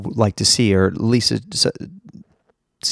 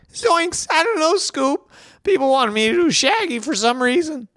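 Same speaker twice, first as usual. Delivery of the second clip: very high, cracked cartoon slacker voice; Shaggy. Shaggy talking to Scooby about a strange request, high-pitched impression. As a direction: squeak it out in a put-on cartoon impression. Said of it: "That's my, that's my Shaggy".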